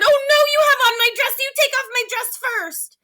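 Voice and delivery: very high, fast shrieking protest; indignant teacher. Acted. Teacher arguing over her dress, very high and fast. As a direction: squeal back in offended outrage. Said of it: "The teacher said".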